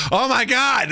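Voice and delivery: high, amazed oh my God; mock stunned gasp. Onlookers stunned by Streep.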